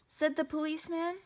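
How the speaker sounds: ordinary delivery, nothing marked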